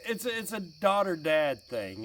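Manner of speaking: ordinary talk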